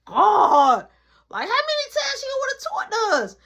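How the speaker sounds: loud, high comic exasperation; exasperated groan. Acted. Told mom wants to talk again, exasperated wail. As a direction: wail it, fed up. Exasperated by another phone call.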